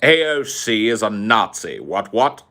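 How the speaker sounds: put-on a pompous British gentleman sneering at the colonies, posh British accent; Sargon's posh British accent. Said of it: "I can't do the accent anymore".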